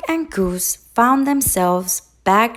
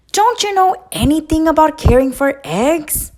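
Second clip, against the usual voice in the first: scolding; 9 semitones higher, 6 dB louder, faster than usual. A narrator's reading. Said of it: "Duck scolded".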